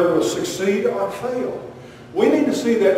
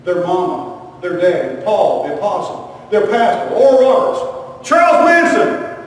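building list; 5 dB louder than usual. Acted. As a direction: build each name up to the last. List building to absurd climax.

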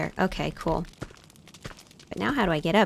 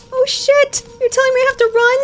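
high, loud, panicked shriek; panicked yelp; 5 dB louder than usual. Real, not acted. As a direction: squeal it out in real fear. Chased by monster in horror game.

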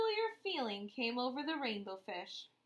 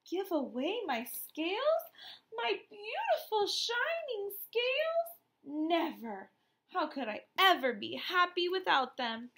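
indignant refusal; 6 semitones higher than usual. Acted. Rainbow Fish refuses.

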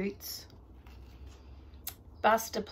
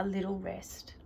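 whispering; 5 dB quieter than usual. A narrator's reading. Whispered sleepy scene.

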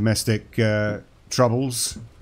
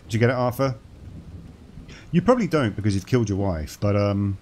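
normal talking voice

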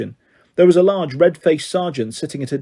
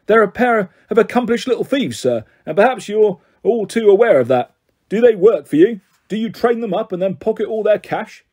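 demanding policeman; 5 semitones higher, 3 dB louder than usual. A narrator's reading. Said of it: "Kitty's policeman demanded".

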